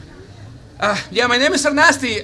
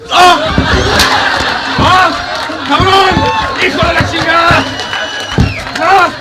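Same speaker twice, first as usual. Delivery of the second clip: loud, high Spanish cursing; angry shouting; 6 semitones higher, 6 dB louder than usual. Acted. Rescuer attacking the sheriff in a mock fight, furious shouting. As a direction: hurl curses between blows.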